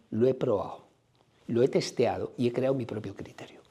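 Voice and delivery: hushed, breathy confiding whisper; whispered aside. Real, not acted. Whispers to the audience about his own experience, a hushed aside. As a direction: drop to a whisper as if sharing a secret. Aside confession after joking remark.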